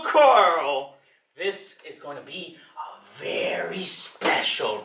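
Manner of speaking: sing-song librarian greeting, then gentler announcement; Uncle Carl. Acted. Librarian welcoming boys and girls to reading hour, performed storytime voice. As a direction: address small children with drawn-out storytime delivery.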